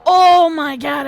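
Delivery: loud, high outburst; excited shout. Real, not acted. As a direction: yell it out in disbelief. Reacting to a missed shot.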